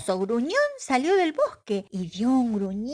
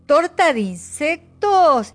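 "torta de insectos" higher, louder, slow in shock; disgusted surprise; 7 semitones higher, 8 dB louder, slower than usual. Acted. The animals react to the bug cake in shocked disbelief.

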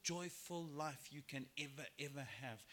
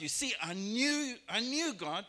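Jonah complaining; 6 semitones higher, 7 dB louder than usual. Retold or imitated. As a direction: play Jonah griping that he knew God was merciful. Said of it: "And so Jonah was like".

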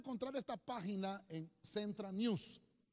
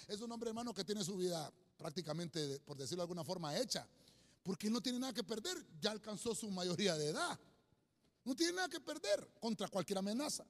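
normal talking voice

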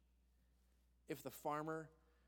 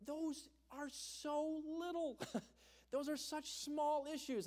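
scoffing disbelief; 9 semitones higher, slower than usual. Retold or imitated. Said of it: "and I'm thinking".

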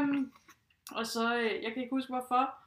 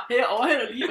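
loud retold yell, laughing; shouted warning; 10 dB louder, faster than usual. Cyclist yelling a warning while overtaking, loud and amused. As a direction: shout it out as you ride past. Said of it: "og så råber".

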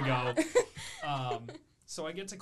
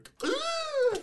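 sudden loud, high hiccup whoop; mom's hiccup; 9 dB louder than usual. Retold or imitated. Mom in the middle of dinner, loud hiccup shriek. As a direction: imitate a piercing gasp-like hiccup.

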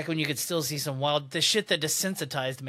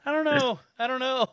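reluctant dog; 8 semitones higher than usual. Retold or imitated. Dog voiced in a joke.

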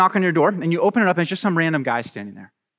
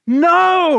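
loud, high, drawn-out NO; mock-outraged shout; emphatic no; 13 semitones higher, 7 dB louder than usual. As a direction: shout down the silly idea. Rejects his own mock welcome.

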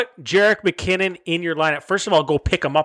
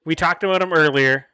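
usual speaking voice

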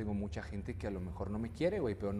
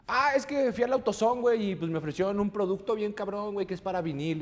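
much higher and louder client voice; imitating the client; 10 semitones higher, 8 dB louder than usual. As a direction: ham up the clueless client bragging. Client explains, voiced in story.

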